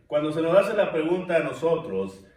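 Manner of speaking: ordinary talk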